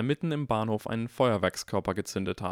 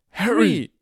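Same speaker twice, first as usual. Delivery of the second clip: loud, much higher cry of the name; alarmed shout; 11 semitones higher, 11 dB louder than usual. Acted. (erschrockener lauter Ruf)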